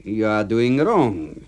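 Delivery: plain reading voice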